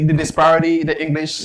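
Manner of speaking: usual speaking voice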